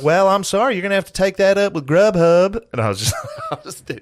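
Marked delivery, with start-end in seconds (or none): 0.0-2.6 high Southern manager voice; Southern accent. Retold or imitated. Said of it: "his southern accent".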